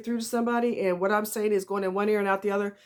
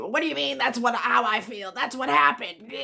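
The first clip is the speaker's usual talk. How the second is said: indignant mock protest; 4 semitones higher than usual. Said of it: "they're gonna keep".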